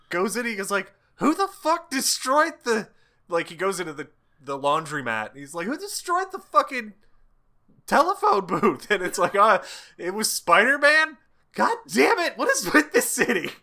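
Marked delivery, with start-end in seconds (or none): 1.2-2.9 mock outrage. Angry repairman asking around. 5.7-13.5 high-pitched, loud exasperated yell; a fed-up guy demanding answers; mock outrage. Repairman in the laundromat, asking in hammed-up fury who wrecked the booth.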